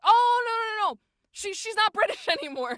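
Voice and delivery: very high, loud acted protest; mock alarmed correction. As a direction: exclaim a hasty correction, half laughing. Retelling team's alarmed correction.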